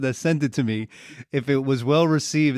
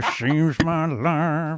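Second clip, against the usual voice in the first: lightly imitating a rock singer; sung slowly like a rock ballad; [name] singer impression; 2 semitones higher, slower than usual. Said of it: "sound just like him".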